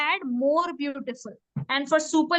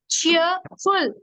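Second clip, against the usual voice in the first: "Cheerful" split as cheer, short gap, ful; syllable by syllable; 2 semitones higher, 3 dB louder than usual. Shown as a demonstration. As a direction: sound out "Cheerful" in two beats. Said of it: "two syllables".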